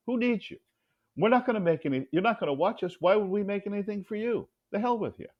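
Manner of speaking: high-pitched, scornful brush-off; mock dismissive retort. Netflix answering a viewer who quit, high mock dismissal. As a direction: imitate a company waving off a complainer.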